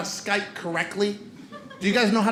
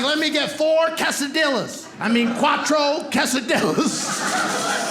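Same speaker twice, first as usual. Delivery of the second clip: high, drawn-out gringo order; struggling Spanish, words stretched at the end; ordering at drive-thru; 5 semitones higher, slower than usual. Acted. Acts out his drive-thru order.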